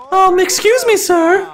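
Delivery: put-on high, timid girl voice. Shy woman politely interrupting an ogre, high girl voice. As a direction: squeak it out like a shy young woman. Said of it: "I'm gonna do some girl voices".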